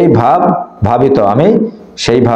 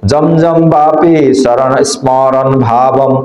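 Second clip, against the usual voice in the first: slow, drawn-out verse with a stop at the end; deliberate teacher's model reading; measured recitation; slower than usual. Shown as a demonstration. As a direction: stretch "জমজম বাপি শরণ স্মরণ ভাবম" out slowly. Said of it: "তাহলে কিভাবে পড়তে হবে".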